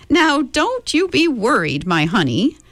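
lightly voiced kindly old woman; reassuring old woman. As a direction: comfort them like a quirky old woman. Mrs Whatsit reassuring, high put-on voice.

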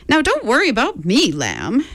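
put-on bustling old lady waving off worry; chirpy old woman, x fast; brisk old woman. Mrs Whatsit reassures Mrs Murray.

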